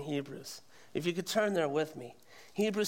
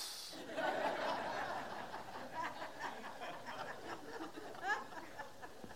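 laughter; 10 semitones higher, 11 dB quieter than usual. Real, not acted. Laughing at own joke.

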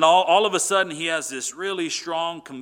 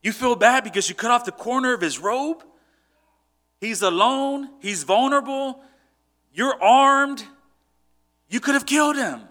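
higher, louder exasperated push; incredulous scolding; 7 semitones higher, 5 dB louder than usual. Retold or imitated. Men pressing David about sparing Saul, higher and incredulous. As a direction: make him see how stupid that was.

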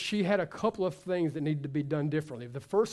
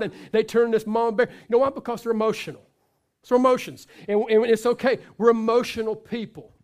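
loud, fired-up sermon; full-force preaching; 4 semitones higher, 8 dB louder than usual. Acted. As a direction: preach it hard to the room.

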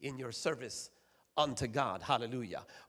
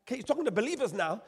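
usual speaking voice

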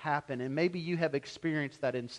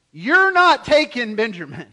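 firm, loud refusal of a father; emphatic no; 5 semitones higher, 10 dB louder than usual. Retold or imitated. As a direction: put your foot down: you're not taking him. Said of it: "no emphatically".

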